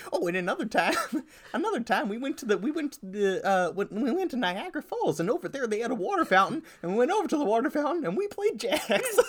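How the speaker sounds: high, laughing granny voice; a giggly old grandma telling stories. Pretend. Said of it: "a grandma?".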